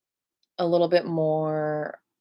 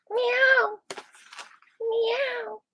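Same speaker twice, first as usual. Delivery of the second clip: much higher mock meows; meowing frog. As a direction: meow like a silly cat. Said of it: "That was a meowing frog".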